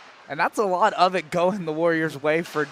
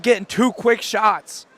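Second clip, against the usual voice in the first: usual speaking voice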